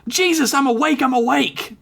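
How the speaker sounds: high, startled shout; startled yell. Acted. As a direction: shout it out just woken. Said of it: "T K yelled out".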